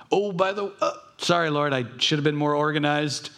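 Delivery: high, hurried mock sorry; flustered apology. Pray-er apologizing to God for an extra request, flustered. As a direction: play the embarrassed afterthought.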